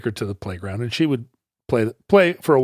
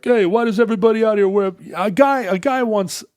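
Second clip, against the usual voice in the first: put-on high, fast New York accent; quick, raised New York accent; brash New Yorker; 10 semitones higher, 3 dB louder than usual. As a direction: rattle it off like a loud New Yorker. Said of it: "the New Yorker's like".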